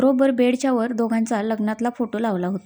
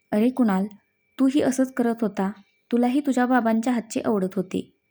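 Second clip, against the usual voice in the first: usual speaking voice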